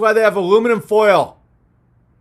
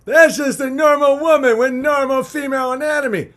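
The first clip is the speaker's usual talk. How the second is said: mocking media voice; 6 semitones higher than usual. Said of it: "mainstream media ... said".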